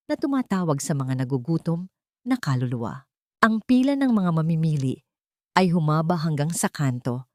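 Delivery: ordinary delivery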